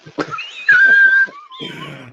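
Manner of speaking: high shrieking laughter. Real, not acted. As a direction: burst into a screaming laugh. Reacting to "half cut.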